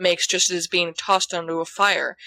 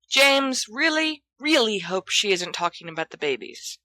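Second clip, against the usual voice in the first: ordinary delivery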